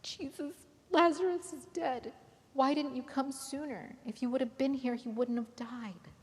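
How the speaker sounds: grieving sister crying to Jesus; crying plea. Acted. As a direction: wail it out in grief. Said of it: "she was crying".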